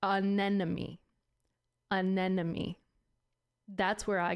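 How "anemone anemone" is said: anemone said slowly, each syllable spaced out; slow careful anemone. Shown as a demonstration. Spacing out anemone so she doesn't trip over it. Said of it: "I have to say it slowly".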